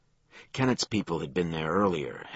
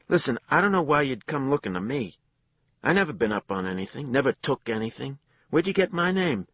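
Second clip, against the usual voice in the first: anxious denial; 11 semitones higher, 7 dB louder than usual. A narrator's reading. Suspect nervously denying to cops.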